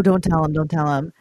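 usual speaking voice

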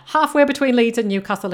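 ordinary talk

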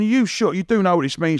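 a hint of an anxious producer; retold warning. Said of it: "she was going".

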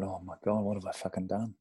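low, hushed retold thought; mock horrified aside. Recalls horrified thought after joke flops.

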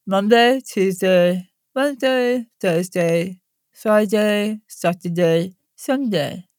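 days said with tongue stuck out. Shown as a demonstration. Coach demonstrating tongue-out warm-up, words blurred by tongue. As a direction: speak around an outstretched tongue. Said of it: "you're gonna stick your tongue out".

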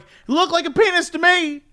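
high-pitched, insistent comeback; hammed-up defensiveness; mock defensive retort. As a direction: act out a stubborn reply up high. His defensive reply in acted quarrel.

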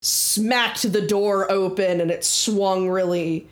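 usual speaking voice

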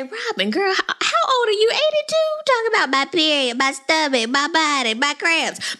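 high mocking whine about her period; high, fast mock complaint; mocking Robin. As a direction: needle her in a high, teasing voice. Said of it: "I wanted to say".

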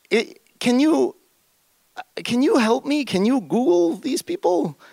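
high, pleading request. Retold or imitated. Asking Dragan for help, high and pleading.

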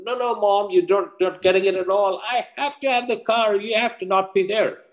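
high-pitched mock teen whine; exasperated teenage son. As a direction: plead as if mom just won't get it.

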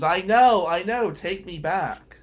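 pleading yell of I know, slowing on take me back; screaming. Retold or imitated. Ex-girlfriend screaming at the man in the Cadillac, loud and begging. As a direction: beg to be taken back, loud and desperate. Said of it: "And she screams".

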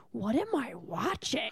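stunned murmur. Retold or imitated. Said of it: "hold back laughter".

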